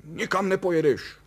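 high-pitched outburst of forbidding; sudden exasperated yell. A narrator's reading. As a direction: burst out and forbid it. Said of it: "rozkřikl se Kalous".

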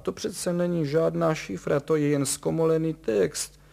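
nervy, grouchy dismissal; grumbling impatience. A narrator's reading. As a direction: grumble loudly as a nervous expert explaining the obvious. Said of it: "bručel pan Horvát".

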